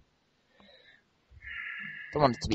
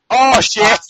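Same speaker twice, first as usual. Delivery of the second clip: high, loud startled curse; startled shout; 8 semitones higher, 7 dB louder than usual. Real, not acted. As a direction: blurt it out in alarm. Startled by the game.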